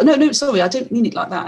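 mock flustered backpedal; flustered apology. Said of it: "And he was like".

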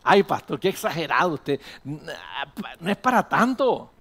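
mock objection from a churchgoer; feligrés incrédulo. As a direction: drag out the dismissive last words. Imagined objection from a congregant. (feligrés que protesta, en tono de burla)

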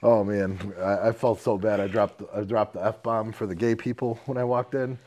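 usual speaking voice

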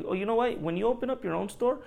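fake-polite jab at a know-it-all; imagined snarky retort to a customer; sarcastic comeback. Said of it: "I always wanna be like".

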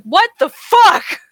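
high, slow incredulous outburst; shocked what the fuck. Retold or imitated. Retelling her reaction to the teacher's MacBook advice, shocked. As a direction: blurt it out in disbelief.

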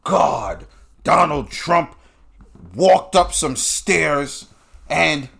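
exaggerated offended complaint; mock outrage. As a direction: draw it out as if scandalized. Mocks people offended by Trump's stunt.

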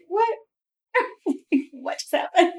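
high, baffled disbelief; puzzled high what just happened; surprised. Retold or imitated. Flight attendants shocked by passenger.

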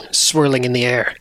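normal talking voice

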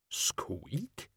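quiet questioning squeak; a soft squeak, rising like a question; tiny rat squeak. A narrator's reading. Death of Rats squeaks.

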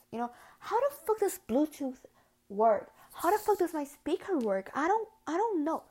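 loud, high, disbelieving outburst; exasperated rant. Real, not acted. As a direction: rant in baffled frustration. Baffled rant about Bluetooth.

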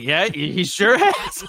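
high, drawn-out, laughing reply; awkward agreement. Retold or imitated. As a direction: mimic an over-keen, unsure yes. Awkwardly answering an unexpected Easter greeting.

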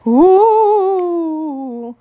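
high, loud ghostly ooh; a drawn-out spooky cave moan; spooky cave wail. Acted. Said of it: "with a whole lot of expression".

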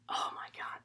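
barely voiced, breath-only exclamation; hushed awe. Real, not acted. Podcaster marvelling at a favourite film line, hushed awe. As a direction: drop to a stunned near-whisper.

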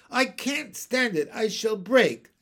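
high, loud helpless protest; overcome pleading. A narrator's reading. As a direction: plead as if about to burst.

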